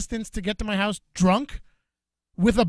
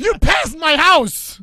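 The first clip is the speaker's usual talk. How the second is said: louder and higher, slow and drawn out; mock outraged yell; 7 semitones higher, 11 dB louder, much slower than usual. Telling her she drove past his house, a slow outraged yell. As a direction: yell it out in disbelief.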